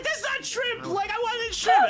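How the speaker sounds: imitating indignant mom. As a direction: protest in mom's voice. Mom complains about shrimp.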